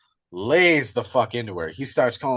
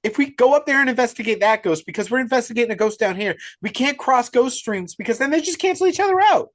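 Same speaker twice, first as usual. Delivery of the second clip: high, loud, panicky protest; hammed-up frightened whine; mock panic; 9 semitones higher, 4 dB louder, faster than usual. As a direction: imitate a scared streamer refusing to go upstairs. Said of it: "And he's like".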